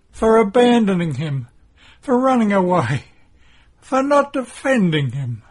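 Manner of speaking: high, loud wail of guilt; agonized, wailed self-reproach; anguished sobbing confession. A narrator's reading. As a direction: cry out each charge against yourself.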